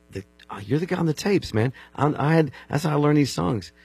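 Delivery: high, delighted outburst; light up on meeting your hero; excited recognition. Retold or imitated. Recognizing the man from tapes.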